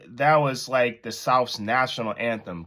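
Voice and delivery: usual speaking voice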